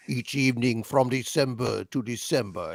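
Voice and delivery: low, soft mock Burton intoning; Richard Burton voice. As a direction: croon it like a grand old stage actor. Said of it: "Richard Burton suddenly goes".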